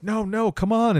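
high-pitched mock protest; mock pleading. Friends to the runner, high mock pleading. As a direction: imitate friends begging him back.

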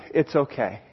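low, soft reassurance; reassuring parent. Acted. Parent forgiving a child who did wrong, reassuring. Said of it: "Mom or dad takes a look ... and goes".

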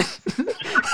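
squeaky high laugh. Real, not acted. Laughing at the mix-up.

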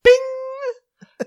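very high, loud notification chime; imitated app ding. Imitating the app that woke him up, high sung ding. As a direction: ring out like a phone alert.

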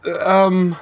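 put-on high-pitched, halting kid voice; nervous cover-up. Boy hides his flight from mom, high and halting. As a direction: stall sweetly with ums, pitched high.

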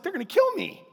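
high, panicky Moses whine; mock panic. Moses panicking that the people will kill him, high mock fear. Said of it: "here Moses is like".

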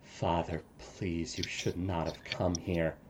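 tearful plea. Acted. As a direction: beg your father quietly through tears. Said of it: "with tears streaming down his face".

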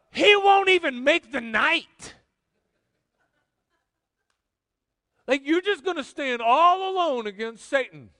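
high, loud, stretched-out preaching; incredulous shout. Real, not acted. Preacher mocking standing alone against Satan, incredulous shout. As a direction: shout it in disbelief, stretching the key words.

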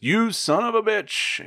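high, slow, outraged imitation of the caller; hammed-up angry customer on the phone; mock angry insult. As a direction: spit it out like a furious farmer. Angry caller's first words quoted.

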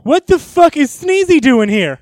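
high, loud, slow indignant demand; outraged disbelief. Acted. Man spotting Sneezy in the house, loud and outraged. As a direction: draw out the outrage, loudly. Sees a hated old acquaintance.

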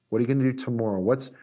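ordinary delivery

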